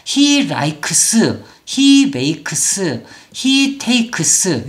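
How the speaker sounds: likes, makes, takes each ending in "keu-seu"; wrong Koreanized endings. Shown as a demonstration. Teacher sums up the incorrect way, Koreanized syllables. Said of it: "옳지 않아요".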